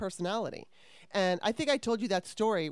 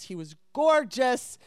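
drawn-out swoony gorgeous, higher and louder; swooning; 5 semitones higher, 8 dB louder, slower than usual. Retold or imitated. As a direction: gush over him slowly. Swooning over the bouncer.

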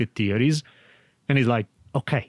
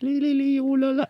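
much higher, sing-song mock cooing; obnoxious gushing; faster than usual. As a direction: overdo the sweetness to the point of silly. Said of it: "it can become obnoxious".

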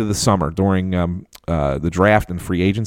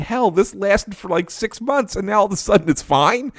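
amazed disbelief; 11 semitones higher than usual. Retold or imitated. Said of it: "I'm like".

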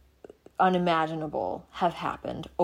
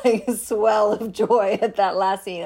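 laughing with joy; 3 semitones higher, 7 dB louder than usual. Real, not acted.

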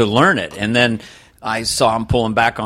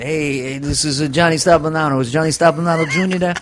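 lightly voiced New York father, pitched up; New York actor dad; 4 semitones higher than usual. New York actor phoning his kid, lightly voiced dad. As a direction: make a casual phone call as a New York dad. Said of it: "I got him calling the kid".

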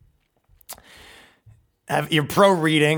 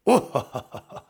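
demonstrated calm laugh; 2 semitones lower than usual. Shown as a demonstration. Man to girlfriend after being insulted, deliberately calm laugh. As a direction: fake an unbothered ho-ho laugh. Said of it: "just a calm laugh".

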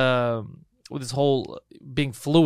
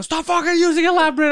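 loud angry yell, pitched way up; 12 semitones higher, 6 dB louder than usual. Retold or imitated. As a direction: shout at him to stop. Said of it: "I started yelling".

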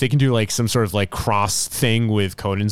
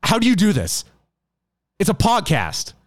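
higher-pitched mock outrage; retold anger; 7 semitones higher than usual. Retelling a podcast host's anger at a prank, indignant. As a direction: quote him indignantly, pitch raised. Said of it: "he was legitimately angry 'cause he's like".

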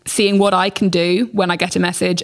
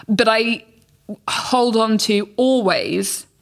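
usual speaking voice

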